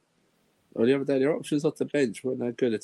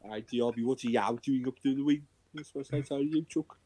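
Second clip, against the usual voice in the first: mock Scouse fan; 4 dB quieter than usual. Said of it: "battle of the accents".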